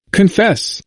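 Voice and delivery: high-pitched cry without real volume; urgent cry. A narrator's reading. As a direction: cry it out as a command, pitched well up. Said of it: "cried the doctors of the Montague".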